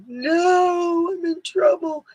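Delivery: put-on high, whiny little-kid wail of dread; hammed-up teary whine; mock whimper. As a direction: squeal it like a kid caught out. Mock panic at hearing full name.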